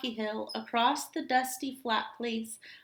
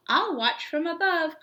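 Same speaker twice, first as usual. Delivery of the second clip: higher, louder call from the sky; calling buzzard; 6 semitones higher, 6 dB louder than usual. Acted. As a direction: call it down from high above. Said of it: "called Buzzard".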